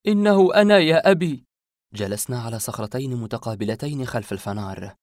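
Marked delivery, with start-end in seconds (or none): 0.1-1.4 loud, high, heartsick outcry; anguished cry. A narrator's reading. Narrator embracing his father, crying out in anguish. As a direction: cry out to be recognised, voice raised. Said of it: "احتضنته وأنا أقول ملتاع القلب".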